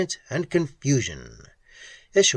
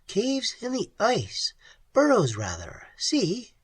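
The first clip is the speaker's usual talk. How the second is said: lightly raised woman's voice; young woman's voice; 9 semitones higher than usual. Acted. Pat shows Ham the burrows, lightly higher voice. Pat's voice in a story.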